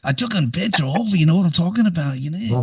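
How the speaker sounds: put-on high, fast Aussie bloke voice, thick Australian accent; heavy street Australian accent. Retelling an Aussie guy on a boat, in a heavy Australian accent. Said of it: "The accent was so heavy".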